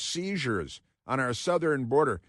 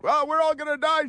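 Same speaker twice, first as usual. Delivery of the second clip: much higher mock hysterics; mock panic; 14 semitones higher than usual. Mocking the yelling crowd in high mock panic. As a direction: shriek it like frightened protesters. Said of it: "tearing their hair out".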